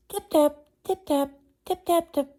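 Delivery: a bright chattering chorus of taps; chorus of little tappings. A narrator's reading. Mice answering one another across the dresser, high excited tapping. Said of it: "came a chorus of little tappings".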